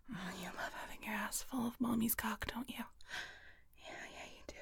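ordinary delivery

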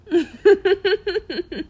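high loud teasing laugh. Acted. Daring the listener to speak Russian, loud laugh. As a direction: laugh at your own dare.

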